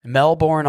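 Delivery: "Melbourne" as spelt, ending in "born"; "Melbourne" with a clear R in the second syllable; mispronounced Melbourne. Shown as a demonstration. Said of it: "how it looks like you'd say it".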